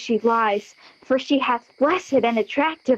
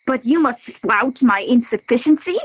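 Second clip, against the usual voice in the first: ordinary talk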